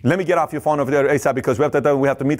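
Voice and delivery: fast, hurried brush-off; fast impatient rep rushing the call. Retold or imitated. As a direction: hurry the customer off the phone. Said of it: "It's not just like".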